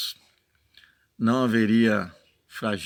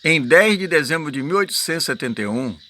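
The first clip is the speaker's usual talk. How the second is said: preaching read-aloud; 4 semitones higher, 5 dB louder than usual. Acted. Reads the prophet's text aloud.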